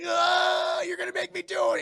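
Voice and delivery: high, loud strained cry; high loud anguished scream; anguished yell. Retold or imitated. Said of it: "And Clark's like".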